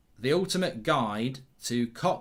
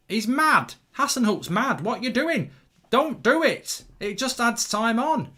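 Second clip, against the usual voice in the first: excited yelling; 8 semitones higher, 5 dB louder than usual. Real, not acted. Fan yelling at the late substitution.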